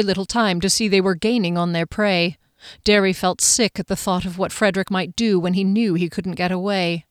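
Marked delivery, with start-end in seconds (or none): none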